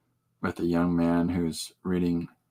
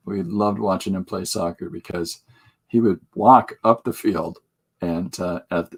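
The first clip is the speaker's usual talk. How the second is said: ordinary talk, nothing marked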